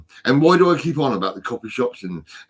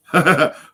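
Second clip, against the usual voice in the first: short high, loud burst of laughter; 7 semitones higher, 8 dB louder than usual. Real, not acted. Man feeling a sudden rush, laughing.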